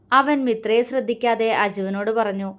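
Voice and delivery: ordinary delivery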